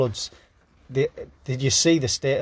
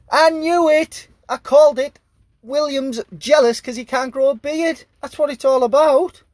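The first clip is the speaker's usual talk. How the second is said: triumphant; 11 semitones higher, 6 dB louder than usual. Real, not acted. Gloating over a royal beard prediction.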